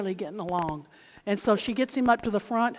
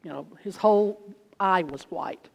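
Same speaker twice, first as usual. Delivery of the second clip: normal talking voice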